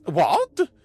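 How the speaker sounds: loud startled sputter. A narrator's reading. Vrodax shocked at being excluded, loud sputter. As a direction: blurt out in shock. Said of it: "Vrodax sputtered".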